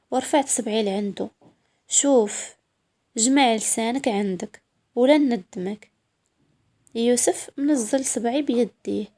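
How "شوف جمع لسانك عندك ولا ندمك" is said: plain reading voice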